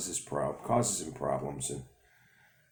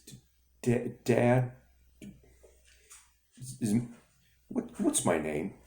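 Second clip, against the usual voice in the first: tearful stutter; 5 semitones higher, slower than usual. Acted. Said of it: "He starts to cry".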